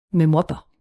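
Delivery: ordinary delivery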